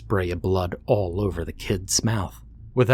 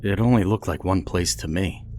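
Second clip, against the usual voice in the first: normal talking voice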